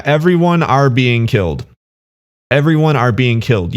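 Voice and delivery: ordinary talk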